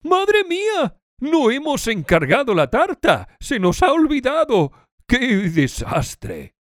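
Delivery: high-pitched panic, slowing into a drawn-out lament; alarmed dismay. Acted. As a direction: gasp out the sudden realization of a disaster. Acted dialogue, forgotten birthday cake.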